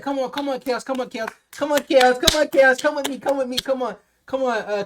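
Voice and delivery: mock someone hurrying him along, high-pitched; pleading coaxer. Urging Chaos to come away from the fight, high mock coaxing.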